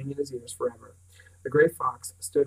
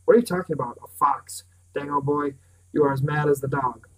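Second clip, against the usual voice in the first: scoffing; 7 dB louder than usual. A narrator's reading. Pritchard scoffing at Bran.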